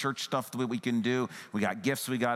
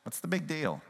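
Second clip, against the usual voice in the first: dismissive; 6 semitones higher than usual. Pretend. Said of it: "Don't hear dismissive Jesus saying".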